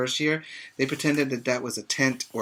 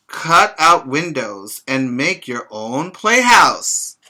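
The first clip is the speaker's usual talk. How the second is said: bright, louder storybook read-aloud; reading to children; 3 semitones higher, 9 dB louder, slower than usual. Acted. Teacher reading a picture book to her pre-K class, lively read-aloud.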